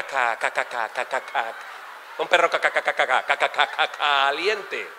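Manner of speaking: low, labored stammer, stuck on syllables; stutterer. Acted. Stutterer ordering a hot dog, laborious stammering. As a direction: fight through each word with repeated, dragged syllables. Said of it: "que son tartamudos".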